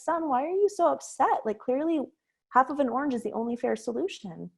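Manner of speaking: a mother coaxing an answer from her son; questioning mom. Retold or imitated.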